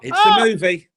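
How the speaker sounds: cracking up mid-line; laughing. Real, not acted. Co-host laughing at the host's cancel joke, blurting it out.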